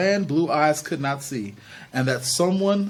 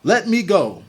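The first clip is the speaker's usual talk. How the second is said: louder, sharp demand; 5 semitones higher, 5 dB louder, slower than usual. A narrator's reading. Charles demanding his sister release him, sharp. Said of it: "Charles said sharply".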